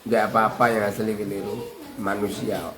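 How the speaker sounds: normal talking voice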